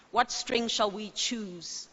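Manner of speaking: usual speaking voice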